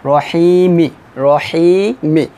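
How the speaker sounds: রহিমি chanted with final ই sounded; recited Quran word. Shown as a demonstration. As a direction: say রহিমি clearly, ending on ই. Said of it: "আমি প্রথম নিয়মটা লিখেছি". (রহিমি শেষে ই উচ্চারণ করে পড়া)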